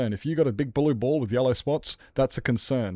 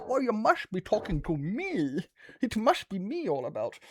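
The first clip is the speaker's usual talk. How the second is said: voicing the cat; 9 semitones higher than usual. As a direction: play the attention-hungry cat. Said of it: "she goes".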